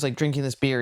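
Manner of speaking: normal talking voice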